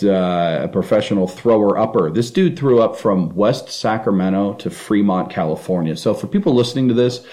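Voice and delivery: usual speaking voice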